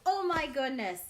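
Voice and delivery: usual speaking voice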